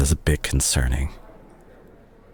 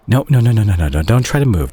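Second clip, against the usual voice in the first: urgent no no; 4 semitones higher, 3 dB louder, faster than usual. Acted. Stopping patient from moving.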